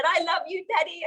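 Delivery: a hint of a little kid crying out; lightly voiced child to her stepdad; childlike plea. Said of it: "like I was a little kid again".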